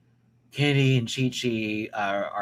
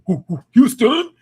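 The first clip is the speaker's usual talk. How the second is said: high, loud hometown cheer; hype shout; 12 semitones higher, 12 dB louder than usual. Real, not acted. Shouting out Houston, loud and high. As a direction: whoop it out for the city.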